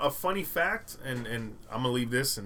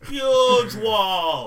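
shouted Trump impression; 8 semitones higher, 10 dB louder than usual.

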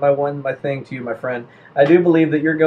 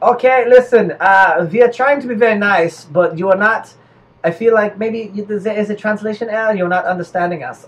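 politely patient mock German accent; 3 semitones higher than usual. Germans trying to be nice to Belgians, halting German accent. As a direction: plead for understanding in a comic German accent.

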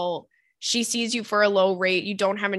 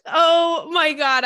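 laughing; 5 semitones higher, 4 dB louder, much slower than usual. Real, not acted. Recovering from laughter.

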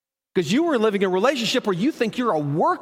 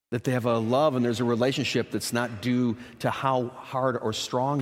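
tender; 9 semitones lower, 6 dB quieter than usual. Real, not acted. Preacher telling the congregation how God befriends them, hushed and tender. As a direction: drop to a hushed, loving tone. Tender close of sermon point.

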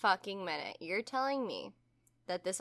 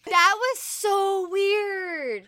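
drawn-out amazed; 10 semitones higher, 7 dB louder, much slower than usual. Real, not acted. Recalling own creepy experience.

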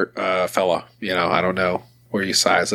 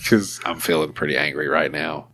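mock restrained anger; 5 semitones lower than usual. Upset employee warning touchy-feely boss off, mock restrained anger.

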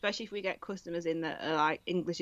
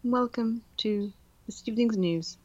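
slow, measured BBC newsreader voice; mock BBC evening-news accent; 5 dB louder than usual. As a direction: put on a softened BBC accent, slowly. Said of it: "that weird B B C accent".

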